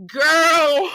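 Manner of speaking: high, loud laughing shout; real laughing yell at the drama; laughing outcry. Real, not acted. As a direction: howl with laughter.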